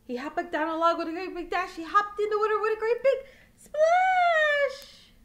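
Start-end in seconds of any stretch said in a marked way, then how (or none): 1.8-5.1 loud, high, laughing splash line; excited splash. Acted. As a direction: go big on the splash to thrill the baby. Big splash climax for baby.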